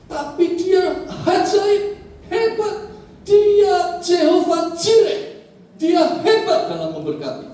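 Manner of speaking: high, loud, drawn-out key words; fervent preaching. Acted. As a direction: proclaim it forcefully to a congregation. Preacher proclaims God's blessing. (khotbah berapi-api, lantang)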